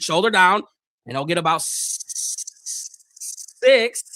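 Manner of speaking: steady commentator call, building as the run breaks; live play-by-play. Acted. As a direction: call the snap to the tackle like a broadcaster. Calls the game live.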